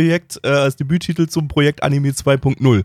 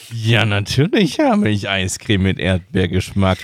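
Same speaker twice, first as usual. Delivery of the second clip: creepy candy man; 4 semitones lower than usual. Acted. Candy man answers kid.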